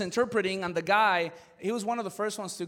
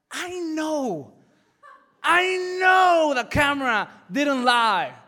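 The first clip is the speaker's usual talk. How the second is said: high, loud, slow scolding; loud incredulous scolding of the defendants; exasperated shout; 8 semitones higher, 9 dB louder, slower than usual. Retold or imitated. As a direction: lay into them with a laughing shout. Telling defendants off.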